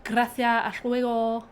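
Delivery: Spain Spanish with a lisped c; demonstrating a lispy Castilian Spanish accent. Shown as a demonstration. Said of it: "It's a very lispy one".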